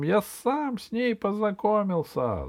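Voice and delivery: high, squeaky gnome voice; small creature insisting on his story; little gnome protesting. Acted. Accused gnome defends himself, high squeaky insistence.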